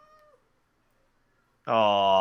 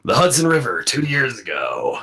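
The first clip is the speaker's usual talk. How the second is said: Solid Snake. Acted. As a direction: deliver it like a grave soldier's mission briefing. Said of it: "doing the Solid Snake voice".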